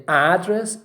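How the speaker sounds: "address" stressed on AD; strong high AD in "address"; first-syllable stress. Shown as a demonstration. Teacher names the noun for students, stressing AD in "address". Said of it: "it's a noun".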